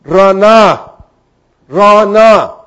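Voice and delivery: rana said slowly, each syllable clear; rana, spoken like a teacher showing a word; Hebrew word rana. Shown as a demonstration. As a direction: pronounce rana as a taught Hebrew word, rolled r. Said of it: "La palabra regocijaban en el hebreo es".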